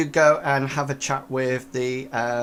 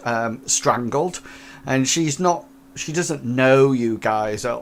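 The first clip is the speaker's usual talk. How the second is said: ordinary delivery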